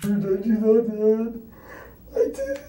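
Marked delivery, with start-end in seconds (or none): 2.1-2.6 high broken sob; crying. Real, not acted. Suspect breaking down crying.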